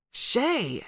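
sharp high scold. A narrator's reading. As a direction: snap her name to stop her. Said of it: "Allie scolded".

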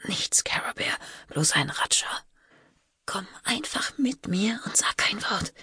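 put-on urgent whisper to a child; hushed reassurance. A narrator's reading. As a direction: brush it off in a whisper. Sister whispers to calm child. (beschwichtigend und drängend geflüstert)

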